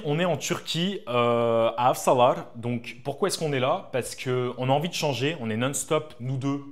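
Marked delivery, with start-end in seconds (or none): none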